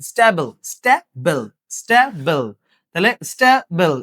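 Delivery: "স্টেবল" broken slowly into স্টে, pause, বল; split into syllables. Shown as a demonstration. Teacher counting syllables for the class, "স্টেবল" slowly split in two. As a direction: sound out "স্টেবল" in two separate beats. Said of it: "এই যে দুইটা".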